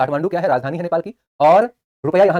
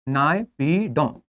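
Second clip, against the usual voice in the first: drawn-out drill; 2 semitones lower, 3 dB quieter, much slower than usual. Shown as a demonstration. Teacher drilling a capital name.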